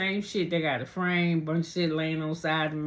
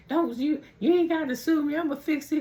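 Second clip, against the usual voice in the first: mocking imitation; 10 semitones higher than usual. [name] mocks brother's sudden eagerness.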